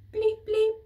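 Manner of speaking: two loud high bleeps; loud high mock keypad beeps; double beep. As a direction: beep twice like a cheerful keypad. Keypad beeps green light.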